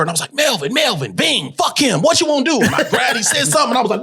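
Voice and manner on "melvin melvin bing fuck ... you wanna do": high, aggressive rapid-fire comebacks; combative outburst. Retold or imitated. Actor replaying his word-association exercise, combative outburst. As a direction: blurt them out ready for a scrap.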